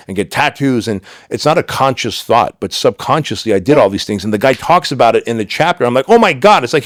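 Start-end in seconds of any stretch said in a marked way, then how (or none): 6.1-6.6 high, loud oh my God; shocked realization. Retold or imitated. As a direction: burst out, as if caught out. Recognizes himself in the book.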